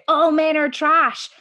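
loud, slow warrior's battle cry; shouted. Retold or imitated. Warrior woman shouting to her comrades, loud and slow. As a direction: belt it out across the battlefield. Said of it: "one of them shouts out".

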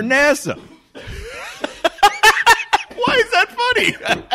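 breaking into laughter; laughing through the question. Real, not acted. Laughing fit.

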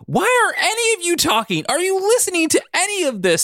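very high, frustrated scream. A narrator's reading. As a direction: scream at everyone to listen. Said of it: "Todd screamed".

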